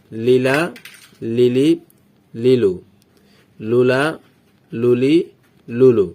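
each item alone with a pause after it: "লা", "লি", "লু"; chant-like syllable drill, "লালা, লালি, লালু", one at a time; memorization recital. Shown as a demonstration. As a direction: recite "লুলা, লুলি, লুলু" as separate, evenly spaced items. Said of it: "মুখস্থ করি, তাহলে কিভাবে হবে দেখুন".

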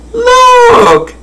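very high, drawn-out jeer; mocking howl. A narrator's reading. Monkeys in trees jeering the giraffe, high mocking howl. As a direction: screech it out mockingly, slowing on the insult. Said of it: "the more they howled".

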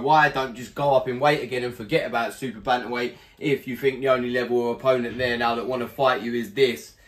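ordinary talk

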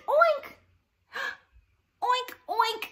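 high loud piggy oink. Shown as a demonstration. Teacher oinking like a pig for the kids. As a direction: squeal the oinks like a piggy. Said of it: "Everybody say oink".

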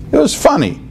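high mock squeal. Shown as a demonstration. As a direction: pitch It was funny way up mockingly. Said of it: "what are you saying".

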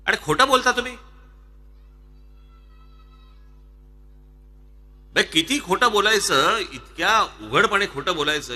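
indignant. Real, not acted. Accusing rivals of lying.

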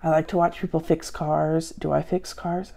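ordinary talk, nothing marked

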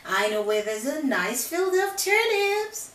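loud, sweetly coaxing wolf; nice wolf. Acted. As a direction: lure him in with fake kindness. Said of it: "He was trying to be nice".

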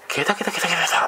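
high cackling laugh, mimicked; mock uncanny cackle; imitated shrill cackle. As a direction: ape the lady's crazy cackle. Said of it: "尋常じゃない笑い方".

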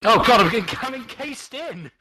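high, loud cry of alarm; loud comic panic, drawn out at the end; alarmed exclamation. Real, not acted. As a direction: yelp it as you find you're trapped. Suddenly trapped inside own build.

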